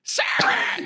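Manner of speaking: squawky cartoon duck yelling a name; mock Donald Duck shout. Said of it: "my impression of Donald Duck".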